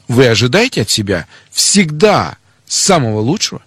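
ordinary talk, nothing marked

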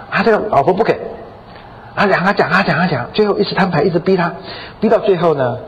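ordinary talk, nothing marked

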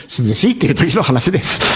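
amused, words broken up by real laughter; laughing while talking. Real, not acted. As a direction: talk through a chuckle. Laughs over fumbled dialect word.

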